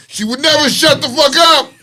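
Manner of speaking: high, loud punchline shout; mock-exasperated yell. Host reporting the husband's claim, exasperated yell.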